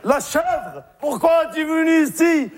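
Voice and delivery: a kid's lightly voiced anguished cry. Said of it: "il s'écria".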